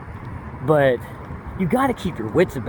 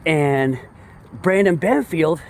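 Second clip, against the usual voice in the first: usual speaking voice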